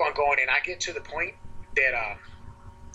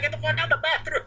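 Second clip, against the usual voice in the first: retold outburst, pitched way up; high, mock-exasperated demand to be left alone. Exasperated retort to hovering girlfriend.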